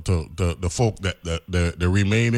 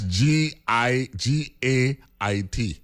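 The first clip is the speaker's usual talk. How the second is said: spelling out slowly; 4 semitones higher, much slower than usual. Shown as a demonstration. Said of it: "By the way, folks".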